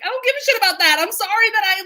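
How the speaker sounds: high, loud blunt apology; emphatic wife. Retold or imitated. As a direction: blurt it out high and frank. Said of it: "she's like".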